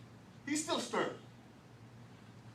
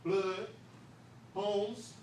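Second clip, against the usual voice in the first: usual speaking voice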